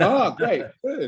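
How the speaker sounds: high, bright burst of delight; light up at the news. Real, not acted. Delighted reply to a fellow Arsenal fan.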